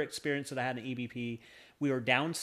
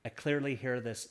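ordinary delivery

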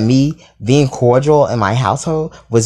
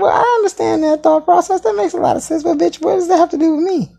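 high, laughing disbelief; high laughing incredulity. Retold or imitated. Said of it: "I'm laughing because I'm like".

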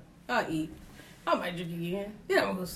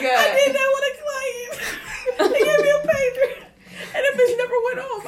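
high, loud laughing rant; laughing shout; 6 dB louder than usual. Real, not acted. Telling friends about a dead pager, laughing hard.

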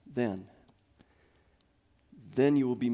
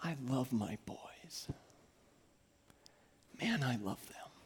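tender; 11 dB quieter than usual. Real, not acted. Dad confessing love for his boys, tender hush. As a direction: confide it gently.

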